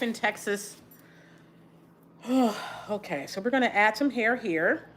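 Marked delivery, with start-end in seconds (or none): 2.2-2.8 real, audible sigh of grief; heavy sigh. Real, not acted. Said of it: "I'm sorry for all the sighing".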